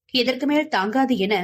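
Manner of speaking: ordinary delivery, nothing marked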